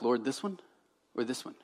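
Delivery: low, very soft question to God; hushed, pretend-pious asking; mock hushed prayer. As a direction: murmur it like an over-earnest little prayer. Mock prayer over choosing a kiwi.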